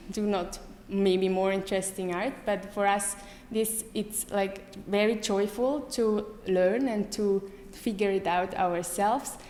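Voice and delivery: ordinary talk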